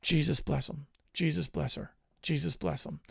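low-key, softly repeated blessing. Retold or imitated. Preacher retelling his prayer over children in India, softly. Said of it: "And I was saying".

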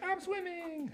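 put-on high, soft little kid voice; dreamy little space kid. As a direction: squeak it like a tiny kid floating by. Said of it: "and he's just like".

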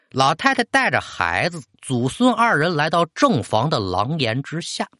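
usual speaking voice

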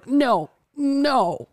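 high, pleading mock cry of no. Patient pulling away from nurse, high crying protest. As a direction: whimper out the no like a scared patient. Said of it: "literally crying and going".

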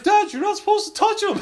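very high, loud little-kid imitation; scolding child. Son telling dad not to touch it, high mock scolding. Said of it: "He's like".

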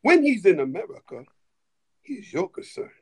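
put-on deliberate, measured African-accented voice. African soldier telling the minister's security who guards him, in African accent. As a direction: imitate an African man's voice, with weighty pauses. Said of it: "He said in his beautiful African voice".

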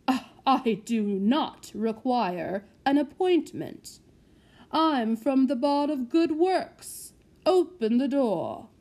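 slow, loud, imperious gentleman; haughty lord. Acted. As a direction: look down your nose and insist. Haughty gentleman demands entry.

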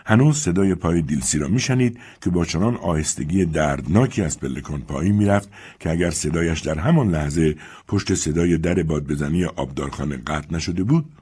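usual speaking voice

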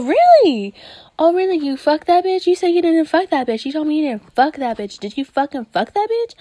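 high, loud, indignant accusation; confronting a liar. Retold or imitated. Catching a partner lying about cheating, high outraged confrontation. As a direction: catch him out and demand the truth. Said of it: "because you're like".

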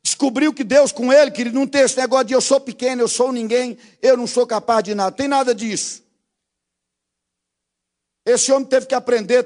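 high, loud, shouted exhortation; fiery pulpit shout; full-force preaching. Acted. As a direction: preach it at the top of your voice to the youth.

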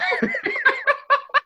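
squealing laugh; high burst of laughter. Real, not acted. Laughing at own joke.

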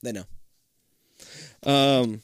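ordinary delivery

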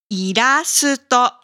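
ordinary talk, nothing marked

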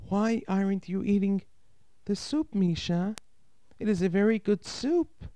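high-pitched voice of a coaxing aunt. A narrator's reading. Aunt urges the hungry boy to eat soup, coaxing.

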